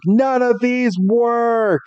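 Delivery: high, drawn-out wail of frustration; mock distress. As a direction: stretch it out slowly in acted despair. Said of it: "it was in such distress".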